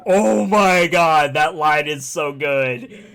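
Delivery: loud, drawn-out fan enthusiasm; gushing reaction. Retold or imitated. Fan gushing to friend over a favorite game line, excited. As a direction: gush it out loud and slow. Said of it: "I was just like".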